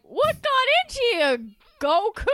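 high, baffled question; puzzled Krillin. Retold or imitated. Podcaster mocking Krillin after being slapped, high and baffled. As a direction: ask it like a hurt, clueless friend. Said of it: "he's still like".

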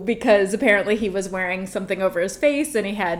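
ordinary delivery

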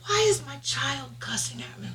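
hushed, high near-whisper; retold stunned question, softly squeaked; bewildered mother. Mom wondering why her child cusses, hushed bewilderment. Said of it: "And I'm like".

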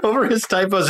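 amused, voice climbing high into a laugh. Real, not acted. Friend teasing him about correcting a famous writer, laughing. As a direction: say it through real laughter, pitch rising. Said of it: "I'm laughing 'cause this is so you".

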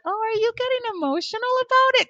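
surprised question. Retold or imitated. Host imitates co-host Stella noticing her tear up, high surprised question. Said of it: "And Stella actually said".

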